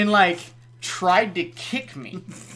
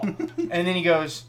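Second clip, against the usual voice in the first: usual speaking voice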